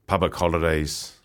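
normal talking voice

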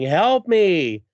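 hammed-up comic-book yell; mock yell.

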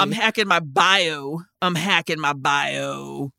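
slow, low mock tech bro brag. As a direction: drawl it like a smug tech bro. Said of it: "such a tech bro thing".

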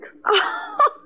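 high, mocking burst of laughter; scornful laugh. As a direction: laugh off the murder accusation.

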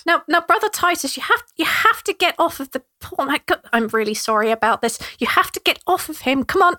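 flustered gnome Trevor. Acted. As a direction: fuss over your brother, embarrassed.

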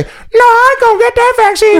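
very high, put-on squawky voice; mock interviewee in a loud-ish falsetto; Susie. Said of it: "Susie, what do you have to say".

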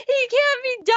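high, pleading protest, lightly acted; mock distress. Fan retelling her upset over the droid, mock distressed.

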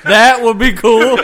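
high, loud, through laughter; real laughing. Real, not acted. Co-host delighted by the Mel Gibson suggestion, laughing out loud. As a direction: crack up while agreeing.

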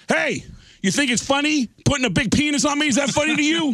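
high, indignant phone complaint; hammed-up outrage; mock angry call. Drummer complaining to the tattoo shop, high and indignant.

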